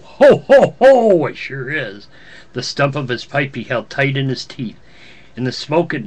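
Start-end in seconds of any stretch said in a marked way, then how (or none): 0.0-0.8 loud high jolly ho ho; Santa laugh. Acted. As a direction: burst out in a booming Santa chuckle. Santa laughs mid story.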